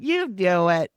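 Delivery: an easygoing celebrity shrugging it off, a touch hammed-up; Roseanne Barr impression. Star at home with wine tells producer to do the show, lightly imitated. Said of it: "excellent Roseanne Barr impression".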